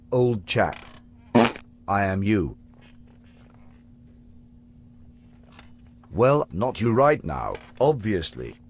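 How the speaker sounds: put-on British accent, lightly done. Future Harper to his younger self, in a put-on British accent. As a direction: future self playing a posh Brit. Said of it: "You adopted this accent as part of your disguise".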